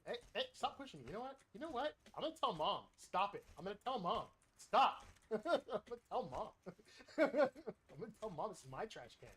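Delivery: put-on whiny kid sibling. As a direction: whine like a kid tattling. Plays bratty sibling with teammate.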